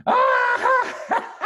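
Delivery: high loud gasp then a giddy laugh; the cider burning his throat. Acted. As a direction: cub gasping then laughing at strong cider. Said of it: "gasped the smallest fox".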